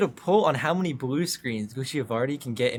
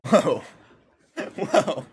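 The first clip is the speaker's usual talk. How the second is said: laughing whoa; 6 semitones higher, 7 dB louder than usual. Real, not acted. Reacting to accent challenge.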